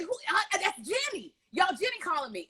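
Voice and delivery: light up as you realize who it is; excited recognition. Retold or imitated. Penguin mask on, spotting her friend's call, excited.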